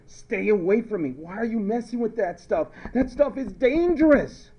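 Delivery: high-pitched mock panic; hammed-up alarm about danger; alarmed warning. Acted warning about chlorine.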